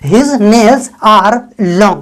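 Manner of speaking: very slow, word by word; slow, clear dictation; slow model sentence. Shown as a demonstration. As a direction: spell it out slowly for learners. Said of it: "तब आप बोल सकते हैं".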